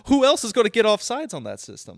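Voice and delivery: loud, high, incredulous question; exasperated outburst. Real, not acted. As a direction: demand an answer in disbelief. Baffled rant about offside rule.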